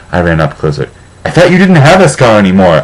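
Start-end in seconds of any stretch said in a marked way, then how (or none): 1.2-2.8 higher, louder shout. A narrator's reading. Narrator shouting at Vampire about his scar, loud. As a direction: call it out in alarm. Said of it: "I shouted".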